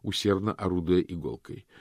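ordinary delivery

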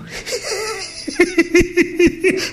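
high, teary laugh of a lost man. Acted. Alfredo laughing again, half crying, lost in feeling. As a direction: laugh like you've lost yourself. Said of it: "E torna a ride".

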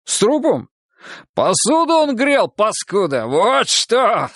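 high-pitched, gleeful exclaiming; uproarious glee. A narrator's reading. Goga springs his joke on startled friend, gleeful. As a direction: burst out with the punchline. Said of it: "захохотал Гога".

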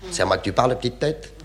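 low, soft gruff waiter voice; a waiter growling at a customer. Acted. Waiter answering the polite customer, low gruff reply.

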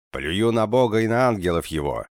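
loud, high shouted vow; loud blasphemy. Acted. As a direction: shout it so it fills the cave. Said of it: "Голос эхом разнёсся по пещере".

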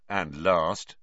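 ordinary delivery, nothing marked

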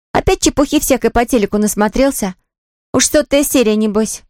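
high-pitched teasing sister; older sister. Acted. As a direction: taunt a younger brother, pitched high. Сестра дразнит брата. (старшая сестра, высоким голосом)

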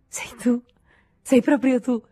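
can't believe he's really here; overjoyed surprise at the door; surprised joy. Acted. Paul sees Henri return.

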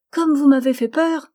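put-on gleeful small girl, full of certainty; joyous child. A narrator's reading. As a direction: burst out with childish relief. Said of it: "fit-elle avec un rire joyeux".

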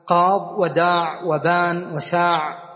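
قاض وداع وبان وساع said slowly, each without final ya; slow demo of endings. Shown as a demonstration. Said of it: "فتقول قاض". (نطق قاض وداع وبان وساع ببطء دون ياء)

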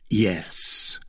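low, hushed, sorrowful yes; saddest yes. A narrator's reading. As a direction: barely voice it, heavy with sadness. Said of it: "each with a sadder intonation".

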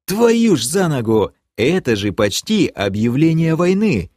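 high, aghast rasp; hoarse exclamation. A narrator's reading. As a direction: curse with a touch of a croak. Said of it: "прохрипел я". (хрипло воскликнуть, высоко)

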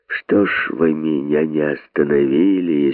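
ordinary delivery, nothing marked

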